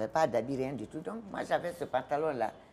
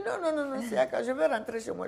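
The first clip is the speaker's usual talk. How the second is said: high, laughing no; laughing no to the official, much higher; laughing refusal; 10 semitones higher than usual. Retold or imitated. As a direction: laugh it off and insist.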